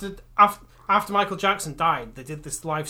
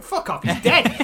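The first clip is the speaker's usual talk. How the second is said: heckle; 6 semitones higher, 6 dB louder, slower than usual. Retold or imitated. Audience heckling fake medium.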